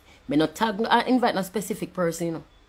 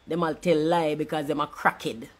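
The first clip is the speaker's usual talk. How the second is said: normal talking voice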